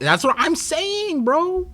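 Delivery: high-pitched, drawn-out exclamation; squealed, slow-stretched agreement; excited agreement. Real, not acted. Emphatic agreement with chat's comment.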